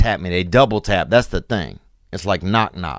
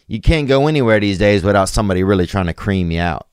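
ordinary talk

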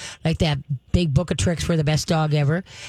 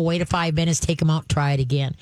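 normal talking voice

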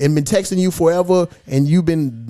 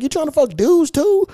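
higher, put-on disbelieving voice; incredulous quote; 9 semitones higher than usual. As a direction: ask it mock-shocked, much higher. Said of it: "I'm like".

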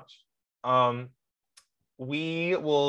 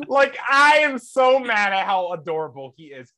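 playfully exasperated gushing, louder and higher; mock exasperation; 4 semitones higher, 7 dB louder than usual. Fan complaining about how adorable Harrison Burton is, mock exasperation. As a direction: rant as if outraged by cuteness. Mock rant over cute driver.

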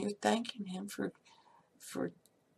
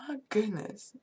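ordinary delivery

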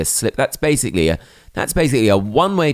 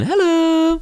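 sing-song hello. Acted. Teasing cohost with a high sing-song "Hello". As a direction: chirp it like a magazine jingle. Playful echo of Hello Magazine.

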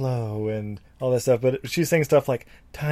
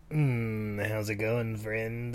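creepy creature voice; 3 semitones lower, 9 dB quieter, much slower than usual. Retold or imitated. Creepy gin voice.

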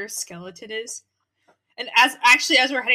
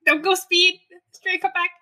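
raised, higher call; 8 semitones higher, 4 dB louder than usual. Acted. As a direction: call out after a friend. Calling out to friend.

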